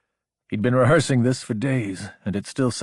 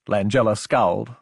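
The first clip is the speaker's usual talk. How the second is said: usual speaking voice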